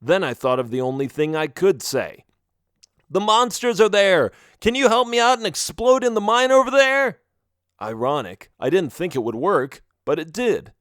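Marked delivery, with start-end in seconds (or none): 3.1-7.2 high, raised shout; calling out. Acted. Melvin desperately asks monsters to explode, calling out. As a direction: holler the request across a field.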